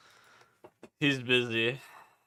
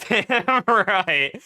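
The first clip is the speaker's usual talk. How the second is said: high laughing burst; 9 semitones higher, 8 dB louder than usual. Real, not acted. Laughing at own outburst.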